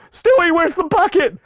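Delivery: loud, high cartoon yell; hammed-up urgent husband shout; Peter. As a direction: holler to the child as a cartoon dad. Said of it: "Oh, Lois".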